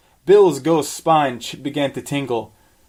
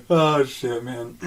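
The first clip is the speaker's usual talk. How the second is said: ordinary talk, nothing marked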